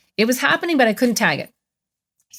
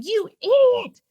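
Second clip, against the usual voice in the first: much higher, scolding wife on the phone; mock shrill scolding; angry wife; 14 semitones higher than usual. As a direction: berate him as the furious wife. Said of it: "she's on the phone going".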